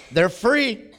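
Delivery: playful street invitation; cheerful call-out. Retold or imitated. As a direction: call out free hugs to passers-by. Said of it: "and I'd go".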